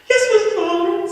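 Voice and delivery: high, loud eager pupil; good boy voice. Acted. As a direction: chirp it like the teacher's pet. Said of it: "in my good boy voice".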